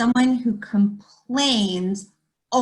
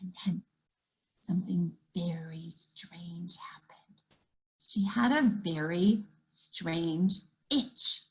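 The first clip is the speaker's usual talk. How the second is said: much softer, mysterious whisper; suspense whisper; 7 semitones lower, 10 dB quieter than usual. A narrator's reading. Storyteller whispering to the kids to build suspense. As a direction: draw the children in with a secret.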